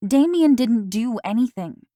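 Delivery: vehement insisting. A narrator's reading. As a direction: insist hard that he's innocent. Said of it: "I insisted vehemently".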